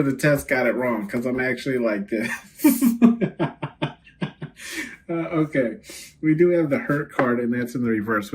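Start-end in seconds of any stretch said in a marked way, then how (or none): 2.6-3.9 high burst of laughter; real amused laugh. Real, not acted. Laughs at own point.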